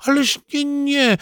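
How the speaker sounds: very high granny protest; high-pitched, insistent granny; reassuring grandmother. A narrator's reading. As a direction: wave the worry away in a high voice. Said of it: "zapewniła Bunia".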